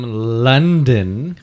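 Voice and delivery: put-on drawn-out London accent. Host says where the beer comes from, mock London accent. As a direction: stretch it slowly with a hint of London. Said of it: "You sound like you're from London".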